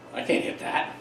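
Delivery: softly muttered, nearly voiceless mock helplessness; poor helpless batter. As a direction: say it under your breath, as the batter. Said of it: "the poor girl's like".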